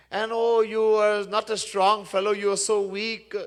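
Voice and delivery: high, mocking false sympathy; taunting. King to strong Bharat, taunting. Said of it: "in this way, he taunted him".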